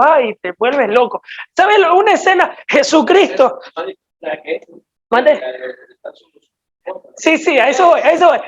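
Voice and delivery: ordinary talk, nothing marked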